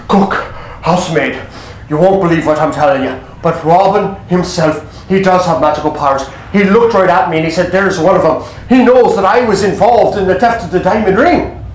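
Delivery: low-pitched butler voice; confiding butler. Acted. Butler warning cook and housemaid, low and urgent. Butler tells the others in alarm.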